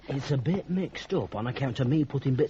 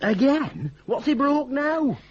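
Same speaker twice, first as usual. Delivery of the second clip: much higher, louder, alarmed questions; startled; 9 semitones higher, 6 dB louder, slower than usual. Acted. Zack alarmed, asking Martha what her father broke now. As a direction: jump at the bad news.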